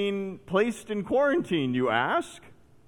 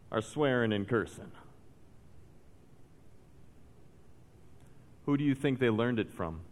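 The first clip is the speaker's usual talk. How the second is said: hushed sermon; 8 semitones lower, 10 dB quieter than usual. Acted. Preacher warning church members, quiet and grave. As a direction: drop it down and let the warning sink in.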